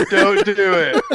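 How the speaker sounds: laughing warning; don't do it, through a laugh. Real, not acted. Laughing plea to stop.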